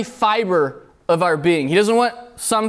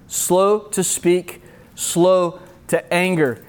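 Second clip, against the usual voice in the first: slow; much slower than usual. Shown as a demonstration. Reading "slow" verse slowly.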